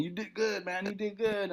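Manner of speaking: soft, calm congratulations; quiet praise. Retold or imitated. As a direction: gently tell him he did well. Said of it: "Mark Yaffe saying".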